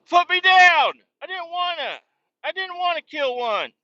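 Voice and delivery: very high, whiny plea after a yell; high-pitched mock pleading; hammed-up distressed protest. Protests being carried by cheering neighbors.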